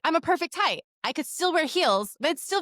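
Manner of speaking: high, lightly sing-song parroting; parroting mom. Retold or imitated. Telling the acting teacher, a high parroted voice. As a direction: recite it like a lesson learned. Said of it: "out of my mouth is like".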